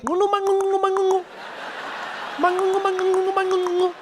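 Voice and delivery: high mock chant of made-up jungle gibberish; fake movie African. Mocking American movies' fake African language, high and chanted. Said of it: "movies where they have Africans and they're like".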